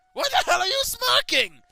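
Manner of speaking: shrill, very loud screech. Acted. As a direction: screech at your own reflection. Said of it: "I screech at myself".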